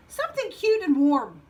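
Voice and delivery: lightly voiced, emphatic wish; wishful frog. Frog tells stranger his wish, emphatic.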